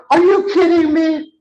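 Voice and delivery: high, loud incredulous squeal; mock disbelief. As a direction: imitate an outraged, half-laughing disbelief. Retelling trainee's disbelieving reaction.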